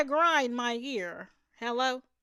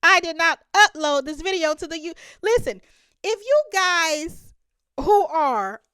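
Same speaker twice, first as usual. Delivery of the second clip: indignant insistence; 5 semitones higher, 6 dB louder than usual. Real, not acted. Insisting to viewers, raised voice.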